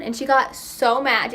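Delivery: normal talking voice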